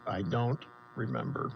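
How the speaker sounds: low, soft, dazed I don't remember; mock dazed Comey. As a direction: play the foggy, forgetful witness. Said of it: "It's his only way out".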